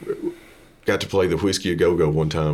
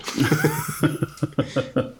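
laugh; 9 semitones higher than usual. Real, not acted. Laughing at own story punchline.

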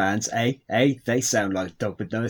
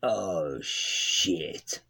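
dismayed mutter; 6 semitones lower, 8 dB quieter, much slower than usual. Acted. Scientist realises dogs suffocated.

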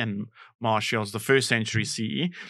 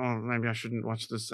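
softer, shy inner voice; timid aside; 4 semitones lower, 9 dB quieter than usual. Retold or imitated. Innocent young comedian at strip show, quiet and shy. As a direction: say it to yourself, a bit embarrassed. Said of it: "I was like".

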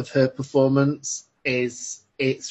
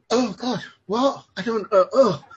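higher, halting shocked stammer; stunned disbelief; 8 semitones higher than usual. Retold or imitated. Viewer reenacting shock at the film's ending, stunned stammer. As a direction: fumble for words in mock shock. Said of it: "and you're like".

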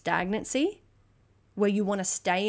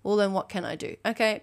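normal talking voice

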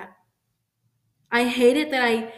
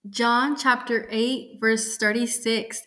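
ordinary talk

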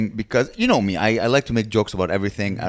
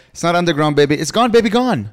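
excited guess; 7 semitones higher, 4 dB louder than usual. Real, not acted.

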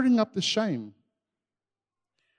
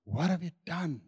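quiet question; 2 semitones lower, 9 dB quieter than usual. Shown as a demonstration. God not accusing the hiding pair, quiet. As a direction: say it softly, held back. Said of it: "Interesting that God didn't come and start by asking".

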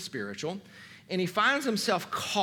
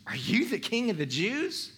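lightly mocking high question; facetious; 5 semitones higher than usual. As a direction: ask it with a touch of mockery, pitched up. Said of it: "Pilate's being facetious".

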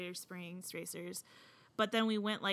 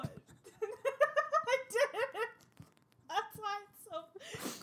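laughing; 13 semitones higher, much slower than usual. Real, not acted. Laughing hard at prank.

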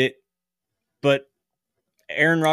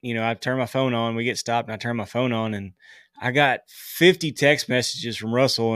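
normal talking voice

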